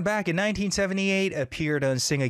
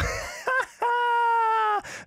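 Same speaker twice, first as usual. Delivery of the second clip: high laugh. Real, not acted. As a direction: crack up after the yell. Laughing after the shout.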